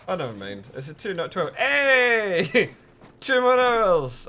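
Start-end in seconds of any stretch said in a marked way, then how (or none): 1.5-4.3 loud, high, drawn-out cheer; celebratory cheer. Real, not acted. Streamer cheering more hours with the viewers, celebratory cheer. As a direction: shout it out slowly in celebration.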